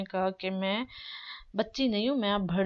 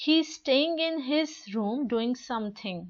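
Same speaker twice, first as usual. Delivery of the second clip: very slow model line; slow read-aloud; 3 semitones higher, much slower than usual. Shown as a demonstration. Teacher modelling the daughter's reply, very slow. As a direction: say each word slowly and clearly. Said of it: "teacher models dialogue line".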